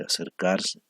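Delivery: ordinary talk, nothing marked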